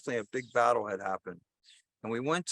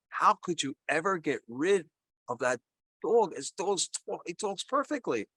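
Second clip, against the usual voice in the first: indignant, baffled questioning, higher toward the end; incredulous; 6 semitones higher than usual. Acted. Man challenging the talking dog's former owner, incredulous. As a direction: pitch climbing in disbelief.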